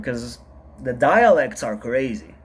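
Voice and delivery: usual speaking voice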